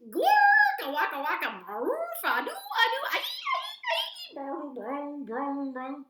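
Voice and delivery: loud, high nonsense noises; silly gibberish. Acted. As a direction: go wild with goofy sounds for the kids. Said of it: "make silly sounds".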